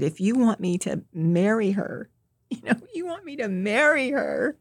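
retold plea climbing to a high pitch; begging. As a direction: imitate pleading with God, rising on the repeat. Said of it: "begging God to let him know".

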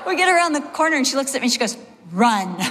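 fast, laughing through the words. Real, not acted. Pastor telling the mall story, laughing fast. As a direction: rush it out while laughing.

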